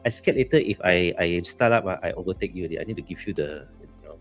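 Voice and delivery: ordinary talk, nothing marked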